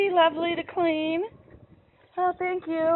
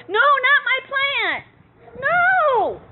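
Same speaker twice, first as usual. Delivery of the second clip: dismayed wail; 8 semitones higher, 4 dB louder, slower than usual. Real, not acted. Cows eating her plants.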